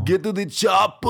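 Arnold impression. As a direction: bark the order like Arnold. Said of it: "I can't impersonate that fool".

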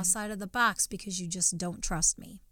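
a soft, knowing reply from above; God speaking gently. Retold or imitated. God gently correcting the small-minded believer, quiet and tender. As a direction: voice of God, hushed and patient. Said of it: "God's like".

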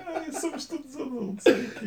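laughing. Real, not acted. Laughing at crude word.

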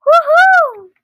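high, loud whoop of joy; exhilarated shout. A narrator's reading. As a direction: let out a soaring whoop. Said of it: "He couldn't help shouting either".

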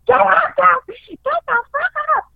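high-pitched retold scream. Mother screaming at her sleeping kids, acted out high-pitched. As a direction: imitate a frantic wake-up yell. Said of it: "I start screaming at my kids".

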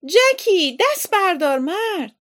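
high, loud exasperated yell; shouting. A narrator's reading. Aunt telling Jackie to give up, shouting. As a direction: yell it out the door. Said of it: "فریاد زد".

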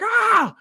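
high-pitched comic scream. Acted. Wrestler landing head first, high comic scream. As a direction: let out a high cartoon shriek. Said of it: "He's screaming".

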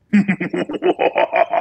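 high shrieking witch cackle; evil witch cackle. Pretend. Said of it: "evil witch's cackle".

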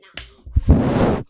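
slow, loud, right on the mic; right in your ear. Real, not acted. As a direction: lips close to the microphone. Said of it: "I'm gonna tap the microphone".

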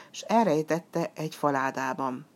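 plain reading voice

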